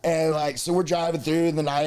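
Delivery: ordinary talk, nothing marked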